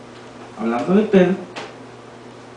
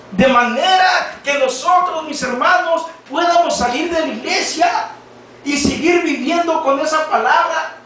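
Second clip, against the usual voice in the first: fervent exhortation; 5 semitones higher than usual. Acted. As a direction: urge the brothers on, full voice. Preacher exhorts the brothers.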